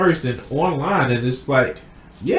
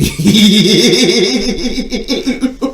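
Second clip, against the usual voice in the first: long high-pitched laugh, louder; high cackling laugh; 8 semitones higher, 6 dB louder than usual. Real, not acted. Laughing hard at his own retold line.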